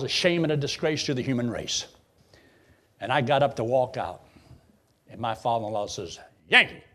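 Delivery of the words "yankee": sharp high, loud holler of a name; imitated call. Father-in-law stops him walking out, imitated loud call. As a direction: call out loudly, high-pitched, imitating someone.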